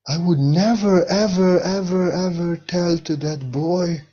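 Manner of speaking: an insistent, slowed never, ever, ever, ever; emphatic never ever. Real, not acted. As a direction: hammer every ever harder. Building emphatic refusal.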